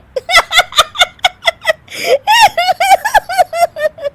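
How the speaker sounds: loud, high uncontrollable cackle; helpless high-pitched laughing fit; high shrieking laugh. Acted. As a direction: burst into a shrill fit of laughter. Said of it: "Anshu फिर से हंसने लगती है".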